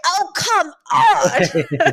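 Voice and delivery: mock-frustrated plea, very slow; exasperated [name]. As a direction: groan it out like the fed-up audience.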